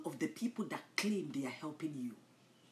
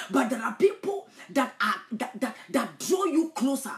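loud, raised preaching voice; full-force sermon delivery; fiery preaching; 7 semitones higher, 9 dB louder than usual. Acted. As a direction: thunder it at the congregation.

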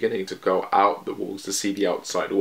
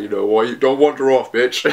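louder, a mild mock snap; mock scolding; 4 semitones higher, 5 dB louder than usual. Levi scolding Eren not to wander off, mock-angry. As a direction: tell him off half-jokingly.

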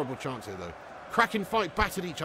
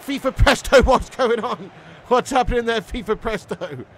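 excited greeting; 9 semitones higher, 11 dB louder than usual. Real, not acted. Greeting a viewer in chat.

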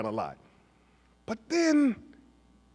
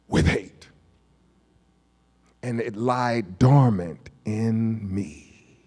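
ordinary talk, nothing marked

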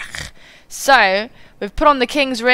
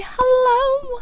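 silly high greeting. Acted. Channel intro greeting.

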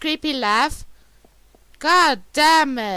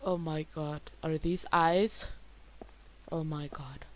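hushed scared whisper; 11 semitones lower, 12 dB quieter than usual. Real, not acted. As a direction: breathe it in fear.

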